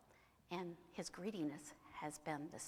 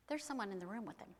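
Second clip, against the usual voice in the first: usual speaking voice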